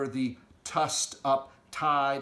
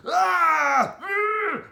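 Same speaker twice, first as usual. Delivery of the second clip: much higher, very loud pained roar and grunt; giant roars; 10 dB louder than usual. Acted. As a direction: roar as you are winched up. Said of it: "The giants roared".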